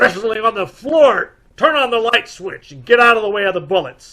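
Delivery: high, loud, urgent shouting; shouting mid-fight. Acted. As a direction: yell orders while wrestling. Fighting gunman in dark.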